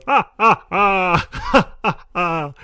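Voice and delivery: high, hammed-up laughter in a sad scene; forced laugh. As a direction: laugh loudly, out of place. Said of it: "and he goes".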